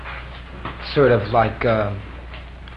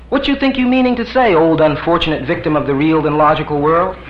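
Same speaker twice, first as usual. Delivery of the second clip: much higher scornful mock; mocking master; 5 semitones higher, 6 dB louder than usual. Pliny mocking the visitor, high and scornful. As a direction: sneer it from on high. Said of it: "Pliny mocks".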